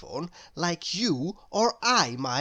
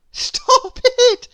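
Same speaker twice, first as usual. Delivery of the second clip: very high, loud giggly cry; laughing protest; 11 dB louder than usual. A narrator's reading. Little Miss Somersault being tickled by Mister Tickle, high loud laughing squeal. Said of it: "laughed Little Miss Somersault".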